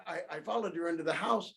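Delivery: usual speaking voice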